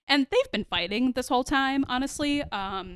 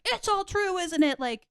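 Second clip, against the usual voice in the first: blowing up; 9 semitones higher than usual. Retold or imitated. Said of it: "just blowing up at her".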